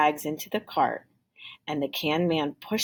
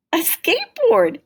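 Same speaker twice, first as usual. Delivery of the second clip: high, loud delighted gasp; gasping surprise; 13 semitones higher, 8 dB louder than usual. Acted. Tim finds the skateboard in the bag, high loud gasp. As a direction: burst out at the discovery. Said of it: "he gasped".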